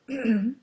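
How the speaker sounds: loud demonstrative throat clear. A narrator's reading. Pig demonstrating how to crow, clearing throat. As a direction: clear the throat before crowing. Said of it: "Clear your throat".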